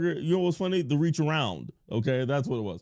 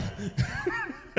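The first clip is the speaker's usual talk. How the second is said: high villain cackle; high gleeful cackle; 5 dB quieter than usual. Acted. Streamer cackling at chatter, high gleeful laugh.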